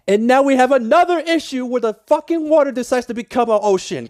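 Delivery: annoyed builder ranting at the water; exasperated rant. Real, not acted. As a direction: vent at the flooding water. Water floods the build again.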